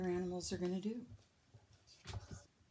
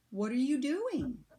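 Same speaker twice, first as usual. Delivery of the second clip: higher, curious sheep question; 6 semitones higher, 4 dB louder than usual. A narrator's reading. Sheep asking Bird what he's doing, curious and higher. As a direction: ask it brightly, lifting up.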